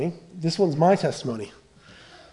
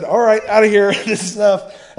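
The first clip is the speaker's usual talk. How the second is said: loud, higher fed-up quote; fed-up exit; 5 semitones higher, 11 dB louder than usual. Retold or imitated. People quitting the church split, loud and fed up. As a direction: announce you're done, raised voice.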